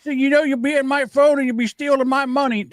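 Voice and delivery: high mock voice of a wary lady on the phone; suspicious lady. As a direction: squeal it like a paranoid lady caller. Paranoid lady voiced in story.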